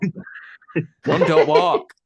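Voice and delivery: slow, loud laughing words; laughing while urging them on. Real, not acted. Joking about rushing to the shop.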